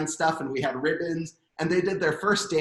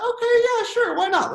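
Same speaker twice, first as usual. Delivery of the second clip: squeaky high eager agreement; eager yes; 7 dB louder than usual. Retold or imitated. To the bar girl, a much higher eager yes. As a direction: pitch way up, thrilled to accept. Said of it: "so we thought".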